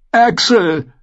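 high, loud desperate appeal. A narrator's reading. Prisoner appealing to Sir Axel, desperate. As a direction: call out to him in despair. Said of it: "sagte der Gefangene verzweifelt".

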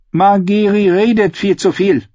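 a snapped retort, sharp and scornful; hissing, irritated queen. A narrator's reading. Queen snapping at her son about Margeri, irritated. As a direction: bite off the words in contempt. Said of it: "fauchte Kersei".

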